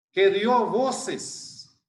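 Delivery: "dio voces" drawn out very slowly, each word weighted; slow stress on dio voces. Shown as a demonstration. Preacher pointing the congregation to the phrase, slow and weighty. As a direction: lean on "dio voces" so it sinks in. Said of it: "note esa palabra".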